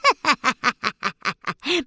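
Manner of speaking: rising wicked cackle; evil witch cackle. Acted. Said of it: "海女巫露出了真面目". (邪恶的女巫大笑)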